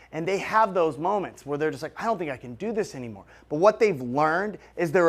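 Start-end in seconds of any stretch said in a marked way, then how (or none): 2.0-3.3 quick, softer admission of doubt; mock self-doubt. Successful person privately admitting a struggle, mildly voiced self-doubt. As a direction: drop it to a quick, quiet confession.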